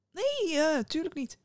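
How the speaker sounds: high, grinning false assurance. A narrator's reading. Innkeeper promising not to say the words, grinning. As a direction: promise it through a sly grin. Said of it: "grijnsde de herbergier".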